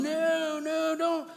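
voice of the flesh. Acted. Preacher voicing his flesh urging grudges, slow and high. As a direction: play the flesh egging him on, slow and high. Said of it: "my flesh is sitting there going".

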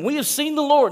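high, loud, thrilled announcement; excited disciples. Retold or imitated. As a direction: burst out with the big news. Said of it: "like, excited that they had seen the risen Lord".

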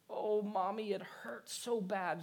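put-on high, slow little-girl voice; hurt child. A narrator's reading. Little daughter to her mommy about hurt fingers, plaintive child voice. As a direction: imitate a small child complaining of pain. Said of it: "said the child".

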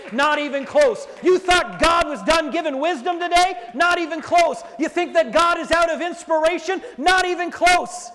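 rising to a shout, high and loud; preacher crying out to the congregation; full-force preaching. Acted. Building sermon climax and prayer.

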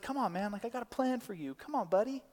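soft, high, gentle coaxing; so sweet. Acted. God coaxing us toward his plan, sweetly. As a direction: coax a buddy softly and sweetly. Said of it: "like, so sweet and he's like".

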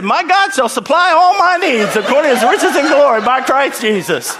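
loud, high-pitched scripture proclamation; hammed-up preacher's cry; fervent declaration. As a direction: shout it out like a desperate confession of faith. Shouts scripture as comic declaration.

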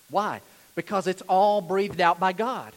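normal talking voice

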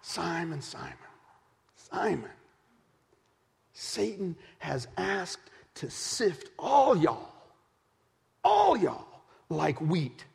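soft, slow, drawn out call; slow tender warning. Acted. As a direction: warn him gently and slowly. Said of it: "Jesus is calling him Simon again".

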